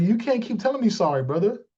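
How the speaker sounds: normal talking voice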